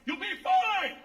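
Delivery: high mock yell of reassurance. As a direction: shout it as if over engines. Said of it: "she starts yelling at me".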